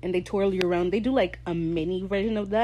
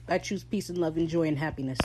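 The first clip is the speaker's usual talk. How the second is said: usual speaking voice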